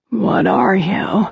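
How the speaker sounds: slow, slurred demon whisper. A narrator's reading. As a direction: let the words slur together slowly. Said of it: "His whispered voice slurred the words".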